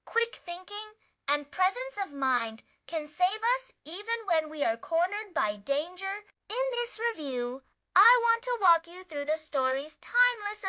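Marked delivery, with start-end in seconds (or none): none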